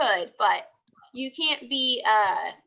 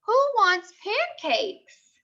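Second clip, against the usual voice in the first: higher, chirpy pancake offer; cheery offer; 7 semitones higher, slower than usual. Acted. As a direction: sell the pancakes brightly. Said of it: "You would say".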